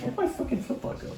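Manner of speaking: quick unvoiced mutter; whispered aside. Real, not acted. Lost his flip flop mid-story, muttering to himself. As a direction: whisper it to yourself, distracted.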